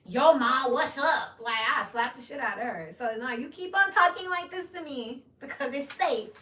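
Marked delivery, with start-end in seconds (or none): 0.1-1.3 mock slangy greeting. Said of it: "how is she supposed to talk then". 2.9-6.3 mock scolding. Scolding her daughter.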